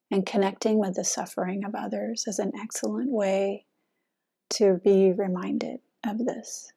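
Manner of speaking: soft contemplative sermon voice; soft meditative dharma talk. Acted. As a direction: speak gently, as if guiding a sitting group. Dharma talk on soldiers' burden.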